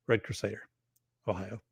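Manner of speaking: quiet low echo after the big intro. Real, not acted. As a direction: murmur it back to yourself. Repeating the name as aside.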